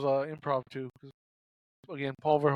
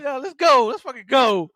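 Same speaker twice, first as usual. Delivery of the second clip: higher, louder cheering; mock hype; 6 semitones higher, 8 dB louder than usual. Friend cheering ED-209 down the stairs, mock hype. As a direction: pump him up.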